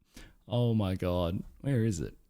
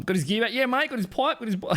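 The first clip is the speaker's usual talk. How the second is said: put-on Australian accent; 11 semitones higher, faster than usual. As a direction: do the bloke from the clip, hammed up. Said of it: "mocking the Australian guy's answer".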